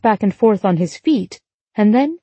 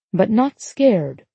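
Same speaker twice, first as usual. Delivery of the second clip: ordinary delivery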